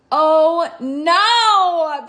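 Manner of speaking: half-laughing loud oh no; loud dismayed cry. Real, not acted. Reviewer yelling at a plot twist, loud and dismayed. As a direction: cry out in mock horror.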